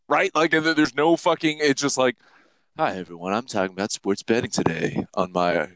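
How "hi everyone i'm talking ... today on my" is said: NPR voice. Retold or imitated. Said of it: "I turn it to N P R Max".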